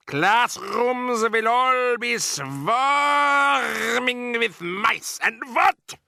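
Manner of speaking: high, slow witch voice; a sinister foreign witch boss; Grand High Witch. Acted.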